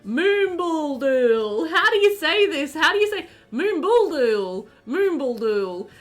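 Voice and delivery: drawn-out Moombaldool, pitched high and loud; loud, hammed-up bafflement at a town name; baffled sounding-out. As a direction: puzzle over the strange name, stretching it out. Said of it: "And we were just like".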